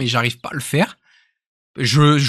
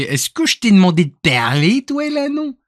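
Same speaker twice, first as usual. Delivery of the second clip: mock Québécois with a high leap at the end; Canadian French accent; 6 semitones higher than usual. Colleague cutting off his chatting pal, mock Canadian French accent. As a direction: imitate an indignant Québécois colleague. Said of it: "et l'autre, il fait".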